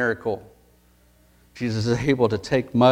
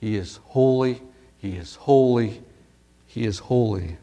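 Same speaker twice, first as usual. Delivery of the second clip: repeated holy, fading; thrice repeated holy; 2 semitones lower than usual. Shown as a demonstration. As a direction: stress on holy each time, slowing and falling on the last. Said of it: "emphasized three times".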